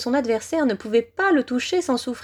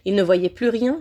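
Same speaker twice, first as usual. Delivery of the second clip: plain reading voice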